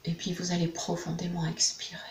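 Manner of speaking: a hushed exhale guide; whispered breathing cue. Acted. Guide leading a client through a breath, whispered.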